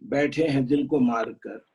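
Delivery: measured couplets with pauses; ghazal recitation. Acted. Poet sharing an old ghazal with friends, measured recitation. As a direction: recite the verse deliberately, half-line by half-line.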